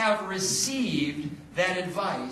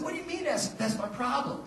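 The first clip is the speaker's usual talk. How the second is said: puzzled retort; 7 semitones higher, 4 dB quieter than usual. Retold or imitated. Confused reply to Lake.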